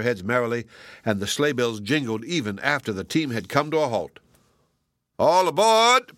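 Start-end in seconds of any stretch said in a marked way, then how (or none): 5.2-6.1 loud, high jolly call; shouting. A narrator's reading. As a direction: boom it out to everyone. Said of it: "shouted the toyman".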